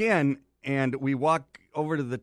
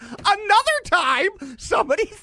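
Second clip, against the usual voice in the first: exasperated; 8 dB louder than usual. Retold or imitated. Exasperated retelling, much higher.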